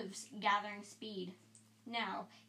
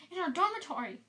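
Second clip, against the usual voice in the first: frightened insistence; 8 semitones higher than usual. Acted.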